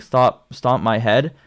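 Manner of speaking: usual speaking voice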